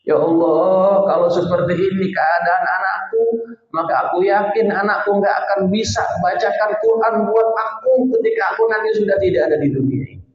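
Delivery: anguished prayer. Retold or imitated. Father praying in anguish over his son, crying out. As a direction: let the grief rise as you plead. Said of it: "sambil dia nangis".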